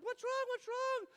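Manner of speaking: loud, high retold dad's panic; alarmed shouting. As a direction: call back in comic alarm. Dad answers child's panicked calls.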